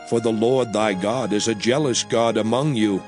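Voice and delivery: normal talking voice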